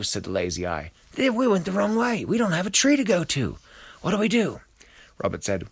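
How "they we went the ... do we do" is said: high, loud fretting; acted high-pitched panic; panicked lizard. A narrator's reading. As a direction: wail that all is lost. Lizard panics with no trees left.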